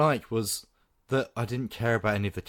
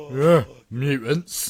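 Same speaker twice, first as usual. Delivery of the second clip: slow sneer; 2 semitones higher, 4 dB louder, much slower than usual. Pretend. Mock bigot sneer.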